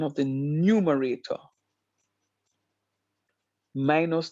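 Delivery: slow, with long pauses between each part of the rule; slowly. Shown as a demonstration. Teacher repeating the quotient rule for students, slow and spaced. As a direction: spell the rule out piece by piece, slowly. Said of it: "Let me say that slowly".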